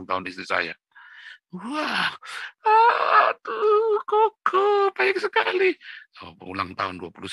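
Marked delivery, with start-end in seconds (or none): 1.5-5.8 very high, loud falsetto gushing; hammed-up high-pitched wifely gratitude; imitated delighted wife. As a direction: squeal like a thrilled wife. Imitates wife thrilled by car gift.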